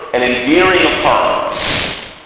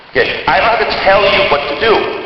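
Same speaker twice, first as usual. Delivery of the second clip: ordinary delivery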